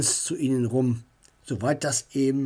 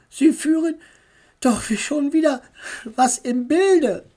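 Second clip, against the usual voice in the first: put-on much higher, loud little-boy voice; child's voice; 9 semitones higher, 4 dB louder than usual. A narrator's reading. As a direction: speak as the little brother. Kind voiced, Mutter angeschaut. (hohe Kinderstimme, laut)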